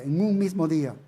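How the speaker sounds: un in "en un mismo día" hit hard as the key word; stress on un. Shown as a demonstration. Preacher repeating the verse to stress one single day, un stressed. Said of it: "que está enfatizándolo".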